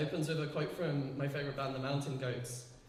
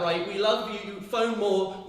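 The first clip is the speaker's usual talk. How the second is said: normal talking voice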